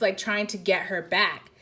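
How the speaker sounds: usual speaking voice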